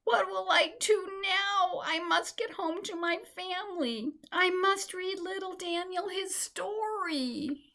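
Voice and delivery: high, slow, tearful; lost little mouse crying for home, high and slow. A narrator's reading. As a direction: cry it through tears. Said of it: "began to cry".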